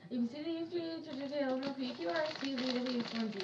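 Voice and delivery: fast alphabet. Shown as a demonstration. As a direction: rattle off the letters fast. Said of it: "how fast I can do it".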